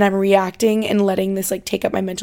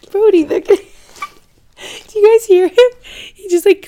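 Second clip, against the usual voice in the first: excited laughing; 12 semitones higher, 9 dB louder than usual. Real, not acted. Dog sniffing the mic mid-recording.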